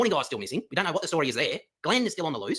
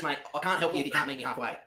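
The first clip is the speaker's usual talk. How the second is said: ordinary delivery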